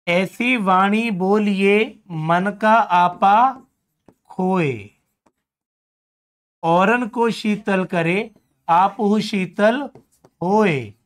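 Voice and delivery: slow, drawn-out recital of the couplet; doha recitation. Shown as a demonstration. Teacher reciting a Kabir couplet to students, slow recital. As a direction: recite the verse slowly, savouring each line.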